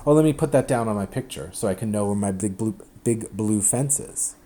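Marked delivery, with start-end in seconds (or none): none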